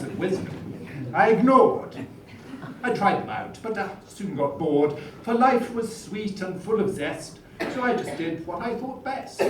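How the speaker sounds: put-on a touch of a plummy Etonian schoolboy accent; posh Etonian accent. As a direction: recite the comic verse in a hammed-up Etonian accent. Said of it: "in my best Etonian accent".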